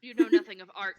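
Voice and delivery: loud. Acted. Insulting the dwarf.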